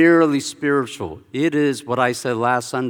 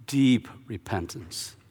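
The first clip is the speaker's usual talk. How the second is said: normal talking voice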